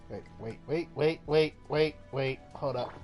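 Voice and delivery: usual speaking voice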